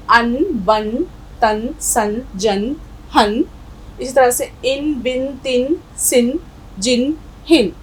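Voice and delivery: "अन", "बन", "तन", "सन" each said separately, ending in n; recited syllable list. Shown as a demonstration. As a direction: run through the syllables one by one. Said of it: "तो देखिए".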